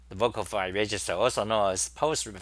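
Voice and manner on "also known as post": normal talking voice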